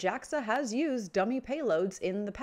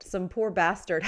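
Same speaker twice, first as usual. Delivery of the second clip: ordinary delivery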